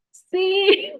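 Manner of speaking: high, loud eager yes; an excited, delighted sí. Retold or imitated. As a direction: blurt it out happily. Retold answer: in love.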